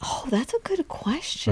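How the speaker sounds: soft unvoiced whisper. Real, not acted. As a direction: whisper it as an aside. Whispered aside.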